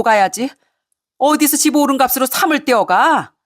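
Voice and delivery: high-pitched mother-in-law tirade; acted indignant yell, pitch climbing on the demands; angry yelling. Mother-in-law phones daughter-in-law over the property split, yelling angrily. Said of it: "고래고래 소리치며 따지는데".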